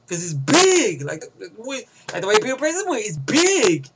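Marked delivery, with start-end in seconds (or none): 0.1-1.0 "big" punched loud and stretched; emphasis on BIG. Real, not acted. Reviewer boasting crudely over the top, heavy stress on "big". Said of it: "too much emphasis on my dick". 3.0-3.9 "big" hit hard and drawn out; emphasis on BIG. Real, not acted. Reviewer repeating his crude boast, heavy stress on "big". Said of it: "too much emphasis on my dick".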